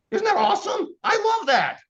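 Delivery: high squealed enthusiasm; gushing excitement. Acted. Trainer cheering the no-special-deals rule to agents, gushing squeal. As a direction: gush it in a very high, loud voice.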